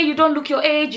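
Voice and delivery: ordinary delivery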